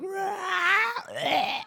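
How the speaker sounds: high, loud mock roar; emotional drunk's roar. Preacher mimics someone drunk on emotions, roaring. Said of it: "you become an emotional drunk".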